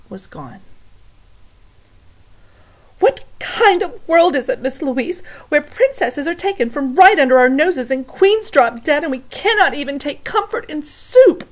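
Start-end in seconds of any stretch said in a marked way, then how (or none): 3.0-11.5 loud, high plaintive lament; despairing cook. Acted. Cook lamenting to Miss Louise, loud plaintive voice. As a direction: plead to the heavens, loud and high.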